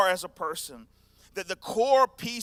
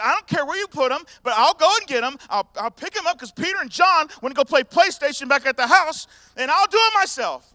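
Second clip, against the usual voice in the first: determined shout; 9 semitones higher, 12 dB louder than usual. Retold or imitated. Mary determined to fetch body.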